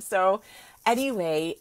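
ordinary talk, nothing marked

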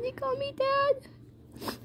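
high, soft, slow murmur; really quiet. Acted. Man moved at being called Dad, high and hushed. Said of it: "really quietly".

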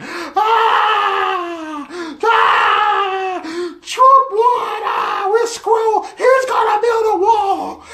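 high, loud wailing shrieks; mock screaming rage. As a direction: scream it like a hammed-up meltdown. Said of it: "go into a screaming rage".

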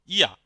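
"ইয়া" opening on a y glide. Shown as a demonstration. Teacher giving the German J example, y sound in "ইয়া". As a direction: start "ইয়া" with an English y sound. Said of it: "ওয়াই এর মতো হবে".

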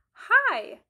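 high, very loud "hi"; acted perky greeting. Teacher voicing Sally meeting Sam, perky greeting.

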